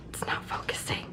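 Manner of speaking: hushed whisper. Real, not acted. Whispering near camera.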